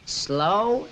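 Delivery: slow and easy. Shown as a demonstration. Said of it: "Now take the club back".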